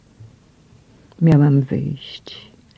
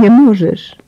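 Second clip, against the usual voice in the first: a raised, higher cry of protest; exclaimed; 6 semitones higher, 4 dB louder than usual. A narrator's reading. Mother begging the queen to spare her son, raised cry. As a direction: push it out louder, pleading hard. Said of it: "wykrzyknęłam".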